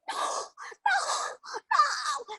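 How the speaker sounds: very high, breathless gasped no; terrified no. Acted. Robber gasps no in fright at the witch. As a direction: squeak it out in panic. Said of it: "he said".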